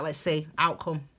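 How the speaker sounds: normal talking voice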